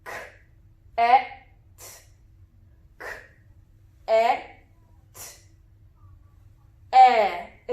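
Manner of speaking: K, E, T as isolated sounds: k, short e, t; sounding out. Shown as a demonstration. Teacher says the sounds, K, E, T each apart, short E. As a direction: say K, E, T as sounds with pauses, not letter names. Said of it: "Let's say the sounds".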